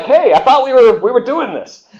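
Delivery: indignant British protest. Retold or imitated. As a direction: squeak out a wounded objection. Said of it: "the British were like".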